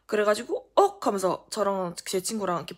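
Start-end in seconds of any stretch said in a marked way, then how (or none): 0.8-1.1 high, loud yelp of shock; retold startled cry; startled gasp. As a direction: blurt it out in mock shock. Startled at seeing a push.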